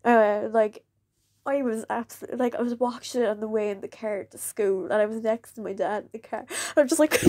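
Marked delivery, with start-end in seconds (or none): none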